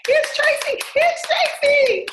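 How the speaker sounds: high, loud sing-song welcome; excited announcing. Real, not acted. Host welcoming a guest onto the show, high excited call.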